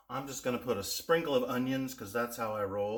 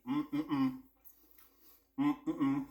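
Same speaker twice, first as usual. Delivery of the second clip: humming mmm. Real, not acted. Savoring the bite.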